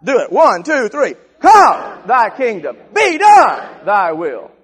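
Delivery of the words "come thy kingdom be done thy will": leading the church in a warfare shout; militant shout. Real, not acted. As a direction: yell it like a battle cry. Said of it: "I want you to get militant".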